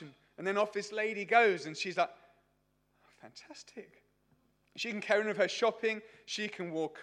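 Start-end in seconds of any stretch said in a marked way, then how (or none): 2.1-4.0 acted hushed, whispery delight; whispered relief. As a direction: whisper it to yourself as if you got away with it. Acted secret relief at being unrecognised.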